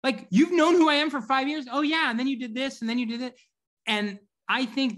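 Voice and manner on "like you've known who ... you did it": amazed disbelief. Retold or imitated. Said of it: "is like".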